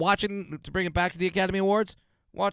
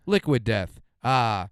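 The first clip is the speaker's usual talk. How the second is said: eerie ad tagline; 5 semitones lower, slower than usual. Pretend. Mock ad tagline, slow and low.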